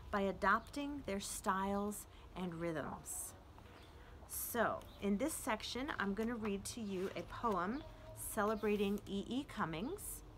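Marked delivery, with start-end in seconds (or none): none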